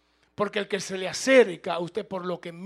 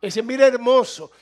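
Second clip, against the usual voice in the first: put-on slow, higher and much louder swooning girl; swooning woman; 4 semitones higher, 12 dB louder, slower than usual. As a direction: gush over a handsome man. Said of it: "Una mujer puede mirar a un hombre y dice". (mujer embobada hablando de un hombre guapo, lenta y aguda)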